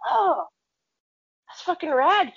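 high-pitched, drawn-in surprise; be impressed by the news; wowed reaction. Retold or imitated. Impressed reply to dad's news.

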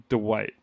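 Dwight said Duh-wight; Dwight with an extra beat at the start; Dwight split into Duh-wight; two-syllable Dwight. Shown as a demonstration. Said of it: "like, two syllables".

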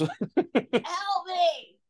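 laughing. Acted. The cry for help again, laughing.

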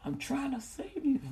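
high, loud, hyped-up call to get on board; hammed-up pleading God, shouting; God as a loud street preacher begging you to come; exaggerated God voice. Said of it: "He ain't say it like that. I'm just exaggerating".

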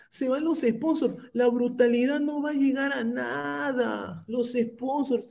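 very high, whining mock lament; mocking critics. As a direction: wail like fretful complainers, stretching the words. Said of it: "decían".

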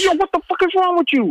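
very high, incredulous outburst; retold shocked shout at Russell; outraged yell. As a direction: explode at him in disbelief. Yelling at Russell over tape.

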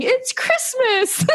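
put-on very high, slow child voice; mother voicing her daughter dancing to worship music, high and giddy; imitating giddy toddler. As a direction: draw it out like a delighted little girl. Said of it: "then she started saying".

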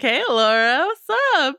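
joking casual greeting, leaping high at the end; mock-chummy hail that shoots up in pitch; Warwick Davis. Host jokes about how Elora was revealed, mock-casual high greeting. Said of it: "It was when Warwick Davis was like".

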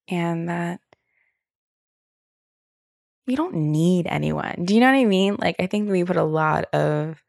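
normal talking voice

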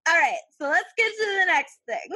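hammed-up cheery, high and loud; bright brisk moving on; upbeat. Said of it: "that came out like".

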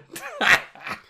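breathy fake villain laugh; mock cackle to close the show; mocky laughter. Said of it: "of my mocky laughter".

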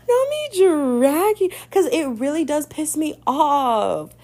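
high, slow, drawn-out wail of annoyance; dramatic whine. Real, not acted. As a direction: wail it like you're fed up. Worked up mid-rant.

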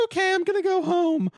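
high, loud squeaky brave farewell; mock chipper exit. Leaving his house after the letdown, squeaky fake cheer. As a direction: chirp it out like nothing hurts. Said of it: "And so I was like".

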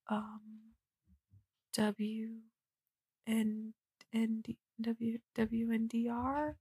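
very soft, slow, halting mock reply; sheepish faltering. Friend challenged on the name, sheepish faltering. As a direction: spell it out timidly, trailing into a question.

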